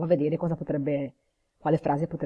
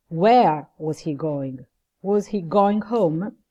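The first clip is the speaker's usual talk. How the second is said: "where" stressed, "was" reduced to a weak form; slow demo with the stress on "where" and "going", "was" unstressed; weak was, stressed question word; 5 dB louder, much slower than usual. Shown as a demonstration. Teacher models weak forms for the class, slow and deliberate. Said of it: "Was, were non sono accentate perché l'accento va più che altro".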